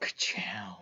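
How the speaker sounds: whispered Ka-chow; whispered catchphrase. Retold or imitated. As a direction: breathe it out softly.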